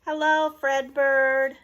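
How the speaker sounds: high and loud hello; cheerful family greeting. Acted. Family waving at Fredbird, loud cheerful hello. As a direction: greet him like an excited family. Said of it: "they said".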